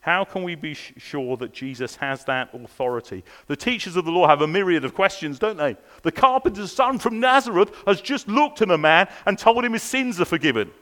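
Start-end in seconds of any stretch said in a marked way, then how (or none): none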